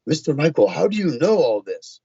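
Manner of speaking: a hint of an Indian lady's accent, high. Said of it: "the lady in her Indian accent said".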